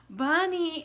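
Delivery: high, loud, thrilled Bunny!; excited announcement. Acted. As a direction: cry it out like a surprise for the kids. Bunny appears in the story.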